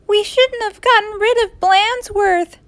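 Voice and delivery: put-on high-pitched child voice; high, dismayed kids; regretful kids. A narrator's reading. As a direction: lament as a sorry schoolkid.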